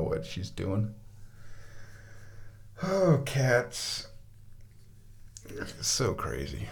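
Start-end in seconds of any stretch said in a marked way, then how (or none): 5.8-6.7 low breathy whisper; low whispered aside. Real, not acted. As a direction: murmur it fondly.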